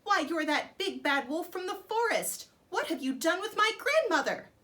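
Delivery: high, loud accusing shout; girl confronting the wolf; angry shout. Acted. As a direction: demand an answer from the wolf.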